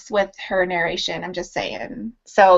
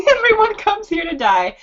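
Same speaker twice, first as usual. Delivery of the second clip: much higher, amused echo of a friend; amused repeat; 11 semitones higher than usual. Retold or imitated. Repeating friend's remark about Pennsylvania to the viewers, amused and high-pitched. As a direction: repeat it back high, in mock amazement.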